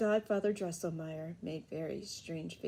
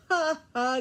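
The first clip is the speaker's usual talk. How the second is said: a higher, louder burst of laughing; loud laughter; 9 semitones higher, 8 dB louder than usual. A narrator's reading. Fred bursts in laughing at godfather, high and loud. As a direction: laugh out loud to cut him off. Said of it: "interrupted him with a loud laughter".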